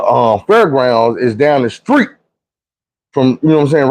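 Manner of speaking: ordinary delivery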